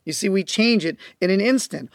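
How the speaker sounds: usual speaking voice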